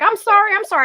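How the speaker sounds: high, loud apologetic squeak; imitating Army for Jesus. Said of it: "And she's always like".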